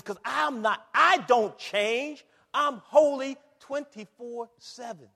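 loud, high and drawn out; emphatic declaration. Acted. Preacher voicing God's unchanging holiness, loud emphatic declaration. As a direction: proclaim it forcefully, stretching the key words. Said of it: "He says".

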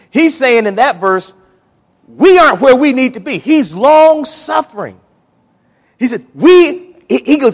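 high and loud, stretched out; full-force preaching. Real, not acted. Preacher exhorting the congregation at full voice. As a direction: pour it out over the church.

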